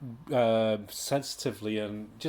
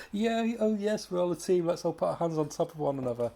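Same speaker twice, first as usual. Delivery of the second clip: higher, gushing mock enthusiasm; mock chirpy team-spirit voice, done sarcastically; sarcastic mock cheer; 5 semitones higher than usual. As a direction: send up the cheesy team moment.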